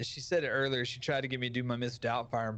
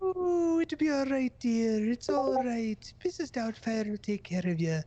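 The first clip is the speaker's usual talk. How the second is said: Mrs. Doubtfire impression; 10 semitones higher, 4 dB louder than usual. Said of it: "That is a good Missus Doubtfire".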